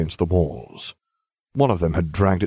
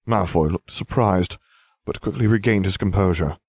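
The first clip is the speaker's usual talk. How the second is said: ordinary delivery, nothing marked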